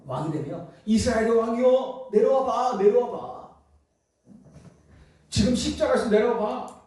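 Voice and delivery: high, goading jeer; mocking. Preacher acts out scoffers to the king of Israel, mocking. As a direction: call up tauntingly to a false king. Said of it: "막 이렇게 조롱하는 거죠".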